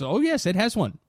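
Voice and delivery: high, mock-assuring caller voice; imitated scam caller. Scam caller insisting the computer has a virus, mock assuring.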